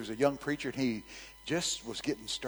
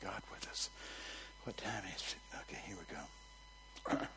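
whisper; 14 dB quieter than usual. Real, not acted. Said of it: "drops to near whisper".

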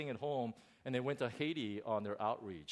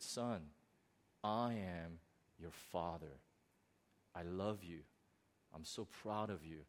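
voice of God; 7 semitones lower, 6 dB quieter than usual. Acted. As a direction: speak it softly as a loving father. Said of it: "I did one of those things".

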